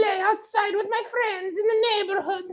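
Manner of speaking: very high whiny mock-Italian kid; Italian child. Child begging mother to stay outside, squeaky Italian accent. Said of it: "I'm your Italian child".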